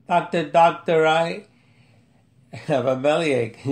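reading a comic poem aloud at an easy pace. Acted. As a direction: recite it like a lighthearted poem. Reads his funny poem aloud.

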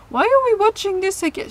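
high-pitched hammed-up German accent, complaining; bad German accent. Von's mother questions the movie choice, exaggerated German accent. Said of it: "attempt at a really bad German accent".

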